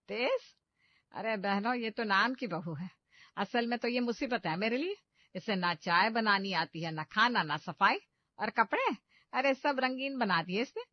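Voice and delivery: loud, scornful mother-in-law belittling the bride; mock her daughter-in-law before the guests; complaining mother-in-law.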